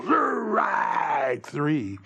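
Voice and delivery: drawn-out umpire's shout; long, roaring strikeout call; selling the call. Shown as a demonstration. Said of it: "You gotta go".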